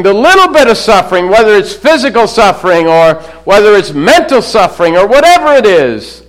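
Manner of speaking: loud, high pulpit build; a fired-up preacher driving the point home; preaching at full force. Acted. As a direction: preach it with rising fervour.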